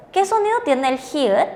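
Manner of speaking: ordinary talk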